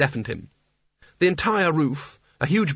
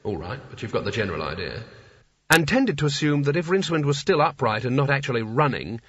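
usual speaking voice

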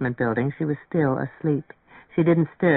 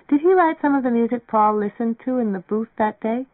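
lightly voiced girl, higher pitch; a hint of an inquisitive girl; curious girl character; 6 semitones higher than usual. A narrator's reading. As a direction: raise the voice a little for her question. Voices Mary Ann's curious question.

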